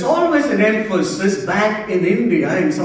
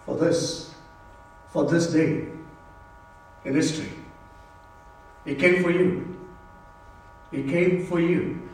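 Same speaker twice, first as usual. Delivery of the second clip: hushed preaching; 6 dB quieter than usual. Real, not acted. Preacher telling church He came for you, hushed and solemn. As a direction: drop the voice and let each phrase settle. Preacher drops down after the climax.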